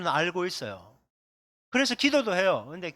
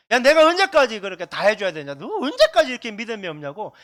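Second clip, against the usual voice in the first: loud, exasperated scolding with a laugh; half-laughing mock rage, much louder; angry scolding; 3 semitones higher, 11 dB louder than usual. Jesus scolding faithless disciples, loud mock anger. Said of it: "굉장히 화를 내시면서".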